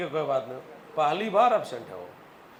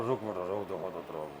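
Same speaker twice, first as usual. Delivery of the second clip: low, muttered threat under the breath, softer; grumbling threat; 10 semitones lower, 7 dB quieter than usual. Retold or imitated. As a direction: grumble a lightly voiced revenge promise. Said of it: "अंदर से घुरो".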